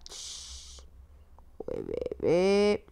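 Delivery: put-on calm the frightened baby gently; soothing hush. Gamer soothes the scared baby with a slow shush.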